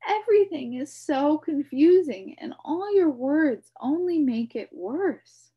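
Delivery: put-on high, loud, frustrated boy; protest, confused. A narrator's reading. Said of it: "protested Milo".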